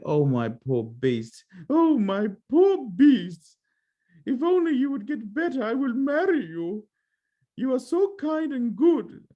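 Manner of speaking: high, slow, drawn-out plea in a put-on voice; Beauty's grieving plea. Kneeling by the dying Beast, high slow grieving plea. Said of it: "That's not how she sounds".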